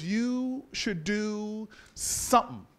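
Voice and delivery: very slow, each word held out; portentous slow pronouncement. Acted. Miss Jones giving her long-awaited advice, portentous and slow. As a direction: stretch it out like prophecy, then land it. Drawn-out pronouncement, then anticlimax.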